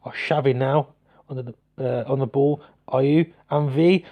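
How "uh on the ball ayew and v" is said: ordinary delivery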